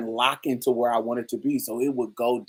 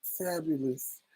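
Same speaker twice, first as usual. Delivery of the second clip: mock fabulous; 4 semitones higher, 10 dB quieter, slower than usual. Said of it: "Talk about".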